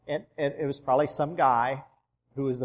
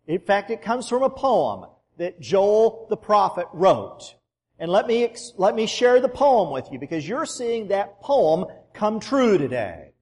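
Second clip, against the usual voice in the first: loud, higher preaching to the crowd; booming voice; 5 semitones higher, 5 dB louder than usual. Retold or imitated. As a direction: proclaim it to the whole crowd. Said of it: "in his booming voice".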